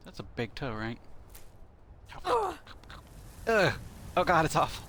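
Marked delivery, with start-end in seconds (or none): 2.3-3.7 a shrill groan of disgust, pitched much higher; a loud "ugh" as if tasting something vile. Pretend. Pretends to eat giant toe. 4.2-4.9 higher, louder cry of mock disgust. Pretends to eat giant toe.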